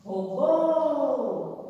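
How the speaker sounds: Robô with BÔ long and sung out; stress on last syllable. Shown as a demonstration. Teacher showing the tonic syllable of Robô, BÔ drawn out. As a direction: hold the final "bô" of "Robô". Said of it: "é o Bo".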